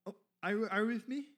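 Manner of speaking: ordinary talk, nothing marked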